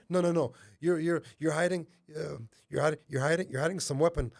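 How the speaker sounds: panicking. Retold or imitated. Attacker suspecting a hidden weapon, panicky stammering. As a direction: imitate a rattled accuser tripping over words. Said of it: "he was panicking".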